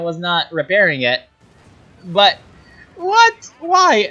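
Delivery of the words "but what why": disbelief. Real, not acted. Caster shocked by a game play.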